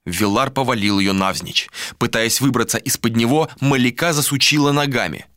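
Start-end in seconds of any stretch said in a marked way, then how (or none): none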